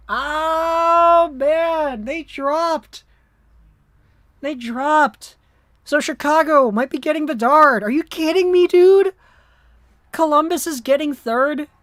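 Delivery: real frustration rising into a yelled dude; real dismay. Real, not acted.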